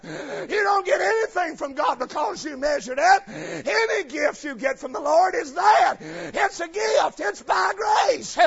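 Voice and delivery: loud, high preaching, stretched on the key words; hammering preacher; full-force preaching. Acted. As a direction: preach it out hard to the congregation.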